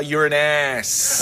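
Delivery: Uranus drawn out, ending in "ass"; alternative pronunciation. Shown as a demonstration. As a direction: stretch the end of Uranus into a slow "nasss". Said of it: "Another pronunciation is".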